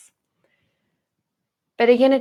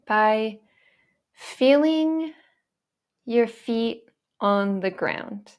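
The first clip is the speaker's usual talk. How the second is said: measured, spacious delivery with long pauses between phrases; guided meditation. Acted. Teacher guiding viewers to feel their feet on the ground, guided meditation. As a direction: guide gently phrase by phrase, leaving room to feel.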